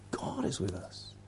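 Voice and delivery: whispered "God is with us". Shown as a demonstration. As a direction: breathe it as a secret. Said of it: "whispered refrain".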